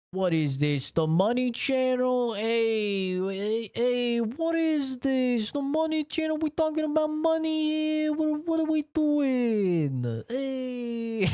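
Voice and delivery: put-on very high-pitched, drawn-out New York accent; New York/Jersey accent. Heckling a money talk, in a high New York/Jersey accent. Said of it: "read like a fucking New York accent or Jersey accent".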